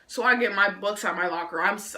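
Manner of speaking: ordinary talk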